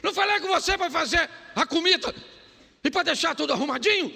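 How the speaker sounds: high, loud, fast nagging wife; mock angry wife scolding her husband. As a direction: scold him for the undone chores. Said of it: "Nesse tom".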